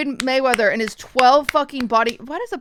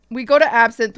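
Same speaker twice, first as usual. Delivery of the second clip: ordinary delivery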